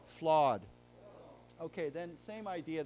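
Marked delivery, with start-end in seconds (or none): 0.1-0.6 flawed said loud and clear with a sounded final d; loud model word. Shown as a demonstration. Teacher drilling flawed with students, loud and clear. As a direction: model flawed crisply for the class. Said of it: "let's say it again".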